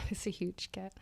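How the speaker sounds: laughing whispered agreement. Real, not acted. Cohost whispers agreement to her partner, giggling. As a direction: breathe it out as a giggly aside.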